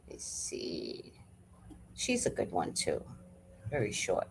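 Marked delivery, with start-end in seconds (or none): 0.1-1.1 soft whisper, slow; whispered aside. Real, not acted. Browsing videos, slow whisper. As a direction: breathe it to yourself.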